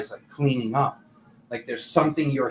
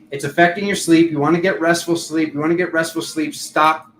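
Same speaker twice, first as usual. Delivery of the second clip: ordinary delivery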